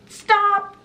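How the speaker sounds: high, loud mock yell; imitated yell. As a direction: cry out like a protester halting a crowd. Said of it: "he yells out".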